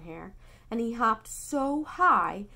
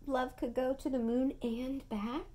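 usual speaking voice

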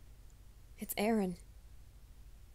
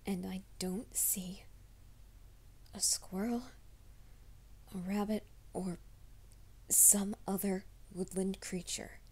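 hushed, anxious whisper to herself; slow, scared whispering in the woods; nervous whisper; 5 dB quieter, slower than usual. Acted. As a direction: whisper it like you're hiding from something. Whispered anxious roleplay monologue.

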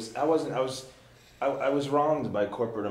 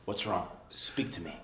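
soft concern; 7 semitones lower, 12 dB quieter than usual. Retold or imitated. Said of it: "And he goes".

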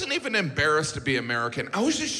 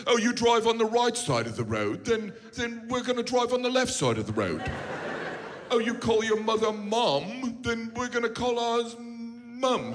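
mock posh British voice, slowed at the end; playing a snooty Brit mocking Americans; 7 semitones higher than usual. Comedian mocks British people copying Americans, mock British voice. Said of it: "They're like".

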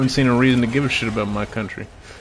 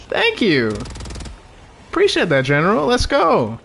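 excited thanks; 7 semitones higher than usual. Real, not acted. Thanking the general in chat.

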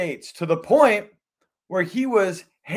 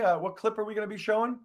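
normal talking voice

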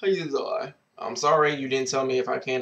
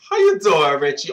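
catchphrase greeting; 2 semitones higher, 8 dB louder than usual. Acted. Greeting the letter writer Richie.

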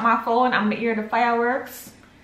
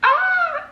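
a short shriek, much higher, a little louder; high joyful shriek; 4 dB louder than usual. Real, not acted. Overwhelmed by the memory, a high happy shriek. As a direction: let out a high happy cry.

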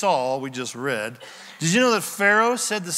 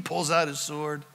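ordinary talk

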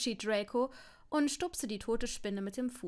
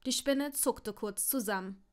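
usual speaking voice

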